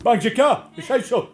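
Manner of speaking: high, loud shouting shop lady; hammed-up shrill shopkeeper retort; mock shop lady. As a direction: squawk it back like an indignant shopkeeper. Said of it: "might have been a lady from the shop".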